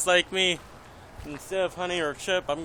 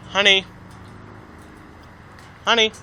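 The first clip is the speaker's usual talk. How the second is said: calling out; 5 semitones higher, 3 dB louder, faster than usual. Real, not acted. Calling a parrot by name.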